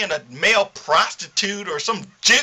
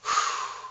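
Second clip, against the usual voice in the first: deep relieved exhale; letting the anger go in one breath; long unvoiced sigh of release; 9 dB quieter than usual. Real, not acted. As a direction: breathe it all out. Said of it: "I think I'm good".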